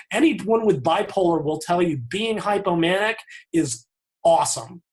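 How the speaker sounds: normal talking voice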